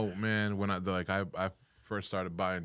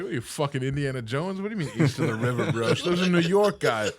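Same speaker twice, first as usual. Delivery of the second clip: higher and louder, ribbing with laughter; mocking retort; 8 semitones higher, 5 dB louder than usual. Jay mocking him about the river, loud laughing retort. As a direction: razz him loudly, half laughing.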